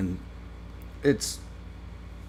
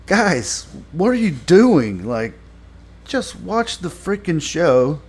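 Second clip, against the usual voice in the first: high, louder exasperated plea; 7 semitones higher, 5 dB louder than usual. Real, not acted. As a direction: plead with them in disbelief, pitch jumping up. Reviewer exasperated, addressing the show's writers.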